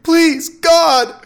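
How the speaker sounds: loud, very high desperate scream; terrified cry to heaven; screaming plea. A narrator's reading. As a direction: scream it as a last-second prayer. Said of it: "I screamed".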